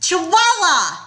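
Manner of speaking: high, loud taunting yell. Acted. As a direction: shout it across the deck to taunt. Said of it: "and yelled".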